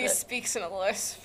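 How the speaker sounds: hushed. Real, not acted. Whispered aside while giggling.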